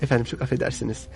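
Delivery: polite apology. Acted. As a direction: imitate a courteous Istanbulite apologising quietly. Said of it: "Böyle bir nezaket şeyi vardı". (alçak sesle, nazikçe özür dileyerek)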